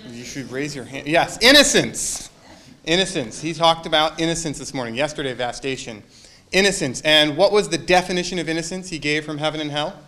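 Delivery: ordinary delivery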